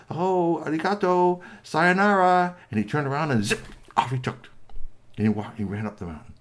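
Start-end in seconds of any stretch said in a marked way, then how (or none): 0.1-2.6 high, loud singled-out farewell words; polite little robot. Acted. Squeaky Toy leaving the troll party, high polite farewell. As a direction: call a courteous goodbye in a higher voice.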